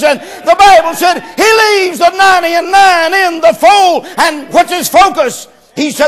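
loud, high, drawn-out preacher's cry; fiery preaching. Acted. Preacher proclaiming the shepherd's search to the church, full-force preaching. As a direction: preach it out to the congregation, building and slowing.

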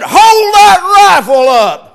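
loud, slow, high commanding shout; stern order. Retold or imitated. Husband ordering his wife on the hunt, loud stern shout. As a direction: bark the order at her to lift it.

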